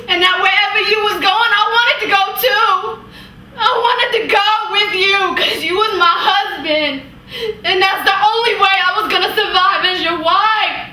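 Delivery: high, pleading outpouring of grief; impassioned anguish. Acted. Wife pleading with her husband, impassioned anguish. Acted monologue, wife's grief builds.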